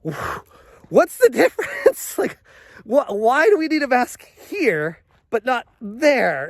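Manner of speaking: mock-baffled, high-pitched question with a laugh; incredulous disbelief. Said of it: "I'm like".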